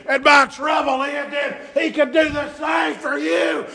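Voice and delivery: loud, ringing preacher's cry; full-force preaching. Acted. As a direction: preach it out to the whole church.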